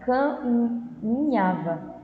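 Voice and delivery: "caminhava" said very slowly, syllable by syllable; teacher slowly sounding out "caminhava"; slow syllable demo. Shown as a demonstration. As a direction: spell out "caminhava" slowly for the class. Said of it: "Vamos pensar aqui".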